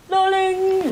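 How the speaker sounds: usual speaking voice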